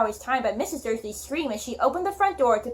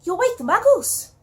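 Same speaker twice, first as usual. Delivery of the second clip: an urgent scolding, pitched much higher; a stern teacher scolding a loud giant, higher and urgent; scolding hiss; 9 semitones higher, 4 dB louder than usual. A narrator's reading. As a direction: tell him off urgently as McGonagall. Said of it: "hissed Professor McGonagall".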